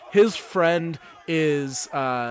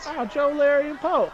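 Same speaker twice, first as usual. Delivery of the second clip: put-on kid voice; 12 semitones higher than usual. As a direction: repeat the names like a child. Kid repeats the names.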